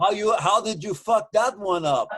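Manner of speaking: impressed, blunt Russian relative; Russian relative impression. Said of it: "he's talking like this to me".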